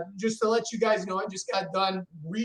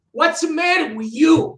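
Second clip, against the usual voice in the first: loud, higher Italian father scolding; imitating father; 8 semitones higher, 7 dB louder than usual. Son voicing his Italian father's scolding, loud. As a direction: bark it like an exasperated dad. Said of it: "my father be like".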